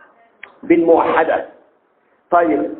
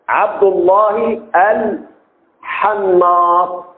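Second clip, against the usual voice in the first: slow dictation; much slower than usual. Shown as a demonstration. Teacher dictating a name slowly for correct spelling. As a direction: stretch عبد الله الحناط out slowly. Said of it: "اللي بيبيع الأكفان".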